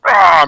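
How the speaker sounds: loud, high ogre scream; loud high alarmed cry; ogre yell. Acted. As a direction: shriek as the ogre bolts. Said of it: "the ogre was like".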